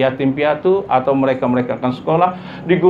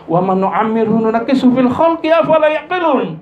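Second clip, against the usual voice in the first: raised chanted Arabic; Quran recitation; 8 semitones higher than usual. Acted. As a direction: proclaim the verse fervently.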